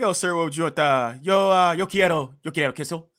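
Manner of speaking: mock tourist attempting a Spanish accent; put-on Spanish accent. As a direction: lay Spanish flair onto the words, hesitantly. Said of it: "a strong Spanish accent".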